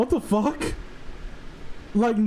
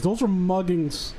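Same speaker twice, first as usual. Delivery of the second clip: usual speaking voice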